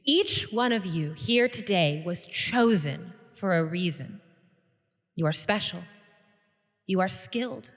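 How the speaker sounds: measured oratory, long pauses between phrases; solemn guild leader. Acted. Guild leader tells pledges they were chosen, solemn speech. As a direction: build to a peak, then settle gravely.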